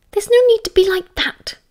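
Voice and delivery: sharp hissed reply to her husband; hissed reproach. A narrator's reading. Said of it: "Lily hissed back".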